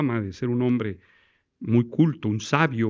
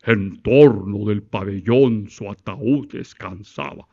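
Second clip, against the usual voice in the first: low, slow, throaty fake-sonorous recital; slow, lower, hammed-up orotund declamation; pompous hollow voice; 5 semitones lower, slower than usual. Shown as a demonstration. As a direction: puff up the voice as if it were booming. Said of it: "Ahí estoy engolando la voz".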